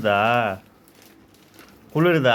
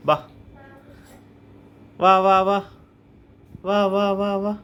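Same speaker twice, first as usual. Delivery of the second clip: loud, high repeated beckoning; raised-voice coaxing call; 7 semitones higher, 5 dB louder, much slower than usual. Real, not acted. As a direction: call a little one over. Calling the little one to come.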